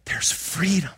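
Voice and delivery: breathy near-whisper; hushed, awed delivery; hushed reverence. Real, not acted. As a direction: whisper it like a precious promise. Hushed climax of the message.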